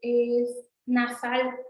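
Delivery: "nasal" drawn out very slowly; "es nasal" slow and deliberate; slow emphasis. Shown as a demonstration. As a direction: stretch "es nasal" for the class. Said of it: "esa na es".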